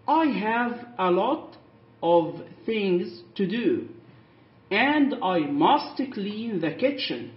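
very slow, word by word; slow, clear reading for learners; slow modelling. Shown as a demonstration. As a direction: lay each word out for the class. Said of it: "كلمة have يعني عندي".

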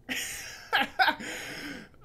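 breathy high laugh; high giggle. Real, not acted. Vlogger giggling at his own question, high laugh.

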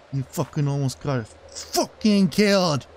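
ordinary delivery

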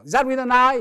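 loud, high questioning barista; querying barista. Acted. Starbucks barista asks for his name, loud high query.

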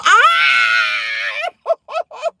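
a squealing scream of horror; shriek of disgust. Real, not acted. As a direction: let out a real disgusted scream. Sees mouse, shrieks.

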